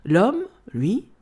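usual speaking voice

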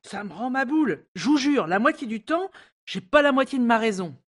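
venting her nerves at full pitch; exasperated. Acted.

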